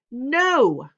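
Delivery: loud high cry of No!; banging the table, a loud shouted No. Acted. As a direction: explode with a loud No. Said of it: "I shout".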